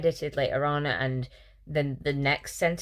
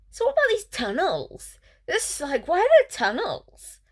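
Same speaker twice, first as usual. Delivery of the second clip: much higher, silly mock voice of the heroine; mock airhead heroine; 11 semitones higher, 4 dB louder than usual. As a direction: play her as a ditzy girl, way up high. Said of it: "then she's like".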